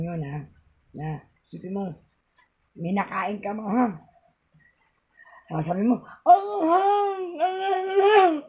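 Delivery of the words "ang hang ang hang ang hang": high, loud shout over and over; repeated demo yell. Shown as a demonstration. Vlogger showing viewers how to yell out spicy pain, high repeated shout. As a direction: yell it to the max like your mouth is burning. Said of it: "isisigaw mo lahat".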